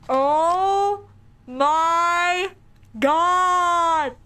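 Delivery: drawn-out, loud, high oh my God; shocked exclamation. Real, not acted. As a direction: stretch each word in loud shock. Shocked at the game character reveal.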